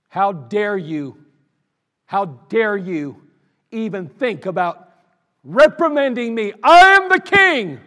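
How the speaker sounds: indignant, building to a furious shout; wroth king. Acted. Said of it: "that's how he said it".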